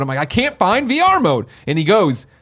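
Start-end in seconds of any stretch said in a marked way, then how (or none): 0.2-1.5 high, slow, fed-up whine; pitched-up frustrated complaint; exasperated. Retold or imitated. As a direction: plead like you've tried everything. Retelling frustrated tweet exchange.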